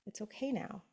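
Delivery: whisper-soft comfort; soft reassurance. Shown as a demonstration. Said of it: "your intuition gets to come in and go".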